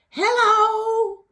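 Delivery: very high, loud, drawn-out hello; imitated excited yell. Showing how the excited man greeted her, long yell. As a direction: stretch out an overexcited mock holler. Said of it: "he was excited because it was like a".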